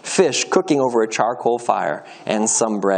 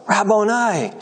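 crying out; 7 semitones higher, slower than usual. A narrator's reading. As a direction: cry out to the teacher, restrained in volume. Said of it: "cried out".

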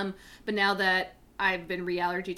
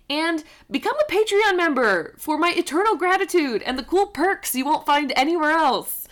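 higher, louder, eager pitch for support; excited plug; 8 semitones higher, 8 dB louder than usual. Real, not acted. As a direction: sell it with big enthusiasm. Enthusiastic Patreon plug.

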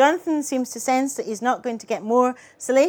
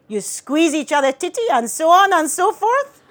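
loud, high, eager guess; prying father; 5 semitones higher, 5 dB louder than usual. A narrator's reading. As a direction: push the guess out loud, rising as it runs on. Father's lurid guess, acted.

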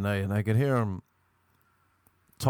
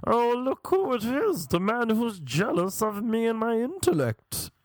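theatrical English-sounding man; 12 semitones higher than usual. Retold or imitated. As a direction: taunt in an exaggerated affected put-on voice.

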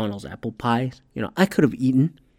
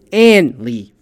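ordinary delivery

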